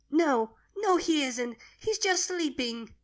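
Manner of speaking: high little voice, pleading denial; a child refusing to believe; denying child. Acted. Baby dragon insists her dead father only sleeps, pleading.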